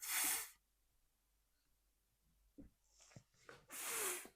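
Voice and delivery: long hissing f, no voice; f sound demo; isolated f sound. Shown as a demonstration. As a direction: breathe out a lone f.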